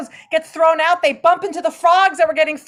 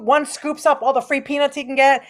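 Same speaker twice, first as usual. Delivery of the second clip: usual speaking voice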